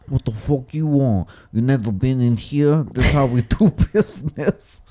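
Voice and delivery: hammed-up rude deli man, laughing at the end; gruff deli owner. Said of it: "He's like".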